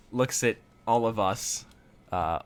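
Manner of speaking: ordinary talk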